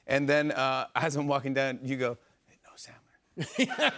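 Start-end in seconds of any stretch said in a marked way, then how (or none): 2.5-3.9 high breathless laughing whisper. Retold or imitated. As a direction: whisper it, half laughing, slowly. Retold whisper while walking.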